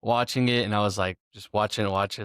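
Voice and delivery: ordinary talk, nothing marked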